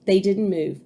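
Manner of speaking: ordinary delivery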